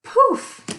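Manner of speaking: loud high burst on Poof; sudden loud magic poof. Acted. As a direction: shout Poof like a magic spell going off. Magic transformation in children's story.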